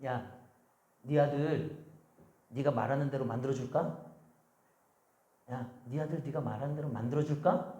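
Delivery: low, soft voice of God; God speaking inwardly. Retold or imitated. God warning a cursing mother about her son, low and quiet. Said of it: "하나님이 그런 마음을 주셨대요".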